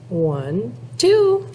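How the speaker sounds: ordinary delivery, nothing marked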